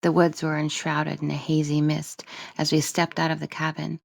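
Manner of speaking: ordinary delivery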